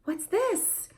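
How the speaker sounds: high soft questions; high hushed parentese questions; soft playful questions. Shown as a demonstration. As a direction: ask the toddler gently. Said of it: "identification of those".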